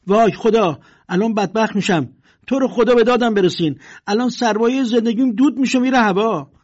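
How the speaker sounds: high, loud wailing cries for help; panicked shouting. Acted. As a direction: scream in panic as your fortune burns.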